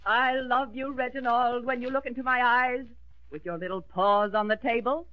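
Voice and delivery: declaimed line, breaking before "on the table"; pause before the table. Acted. Pupil follows the coach's directions, pausing before the table. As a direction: recite grandly, holding back "on the table". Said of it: "pause before the words".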